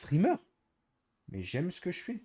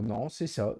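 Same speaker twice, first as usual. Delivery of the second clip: ordinary talk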